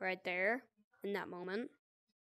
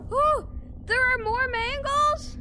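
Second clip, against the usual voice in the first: high dismayed panic; 11 semitones higher, 5 dB louder than usual. Real, not acted. Dismayed at more mangles.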